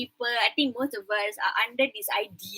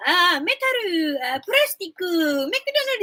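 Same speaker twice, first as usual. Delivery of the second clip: slow, high Japanese-English mock pronunciation; light Japanese accent impression; 5 semitones higher, 3 dB louder than usual. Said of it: "Japanese speakers have Japanese English".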